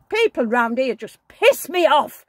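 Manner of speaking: loud angry outburst; retold shout. To her husband about the neighbours, a loud angry rant. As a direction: let rip at the neighbours. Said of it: "I was shouting at the top of my voice".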